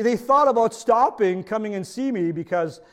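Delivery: ordinary talk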